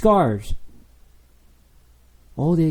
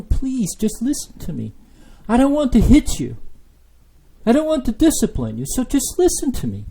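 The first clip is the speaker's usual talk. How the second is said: crying plea as God; 8 semitones higher than usual. Retold or imitated. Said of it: "he's crying".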